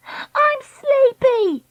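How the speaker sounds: put-on high, long-drawn childish wail; screamed. Treacle overtired on the trolley, screaming it out. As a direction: stretch it into a slow shriek. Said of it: "Treacle screamed".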